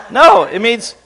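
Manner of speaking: ordinary delivery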